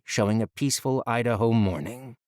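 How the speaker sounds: ordinary delivery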